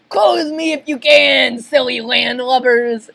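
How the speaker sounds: cocky sea-dog challenge; taunting pirate. Acted. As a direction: jeer down at them boldly. Krabs taunts from the beam.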